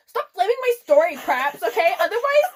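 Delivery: high, loud hammed-up scolding; mock indignant. Author scolding flamers in her note, mock outrage.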